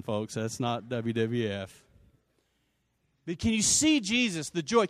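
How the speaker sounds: ordinary talk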